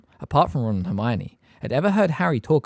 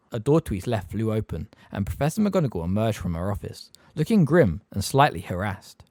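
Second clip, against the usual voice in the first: usual speaking voice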